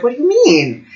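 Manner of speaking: high-pitched puzzled question; puzzled disbelief. Retold or imitated. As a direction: re-enact a baffled reaction. Retells own puzzled reaction to friend.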